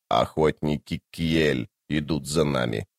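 low, soft, ominous monotone; deep undead warrior. Acted. Tlan-Imass warning of hunters behind, deep and soft. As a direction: intone it deep and quiet.

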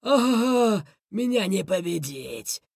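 drawn-out laughing bandit boast; slow, triumphant gloat. A narrator's reading. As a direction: boast through a villainous chuckle. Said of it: "рассмеялся он".

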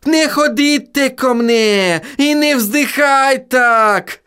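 high, slow anguished howl. A narrator's reading. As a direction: wail it in torment. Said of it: "взвыл Отто".

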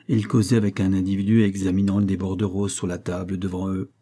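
plain reading voice

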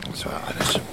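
soft whispered close. Acted. Old singer ending the talk, whispered. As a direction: let it fade to a whisper.

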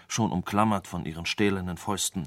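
ordinary delivery, nothing marked

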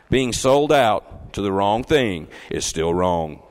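loud, low and slow, weighing each word; emphatic repeat. Real, not acted. Preacher repeats his point to the church, emphatic. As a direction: drive it home in a slow, deep, loud voice. Repeats it after checking they heard.

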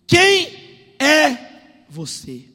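loud, slow, word-by-word demand; thundering question. Acted. God demanding Jacob's identity, thundering slowly. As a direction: boom it out, first word high.

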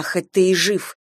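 plain reading voice

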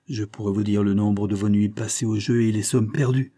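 usual speaking voice

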